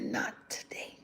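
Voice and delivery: breathy whisper, almost no voice; whispered retort. Retold or imitated. Telling the surgeon's team, whispered retort. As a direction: hush it like a secret reply. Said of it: "And I said".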